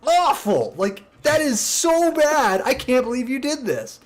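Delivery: high, squeaky mock-outraged whine; outraged coworker. Coworker whining at the prankster, high and disgusted.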